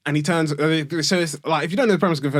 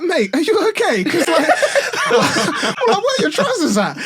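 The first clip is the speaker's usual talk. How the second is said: high, loud, laughing through the words; can barely ask it for laughing; a retold mock question cracking up with laughter; laughing disbelief; 14 semitones higher, 6 dB louder than usual. Retold guy questioning drunk man.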